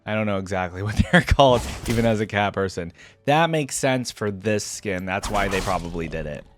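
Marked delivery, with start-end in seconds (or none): none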